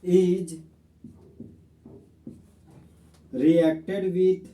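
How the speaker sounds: very slow, word by word, for notes; slow dictation. Shown as a demonstration. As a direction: dictate each word slowly to the class. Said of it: "अब हम लोग दोस्त इसका definition लिखेंगे". (धीरे-धीरे लिखवाना)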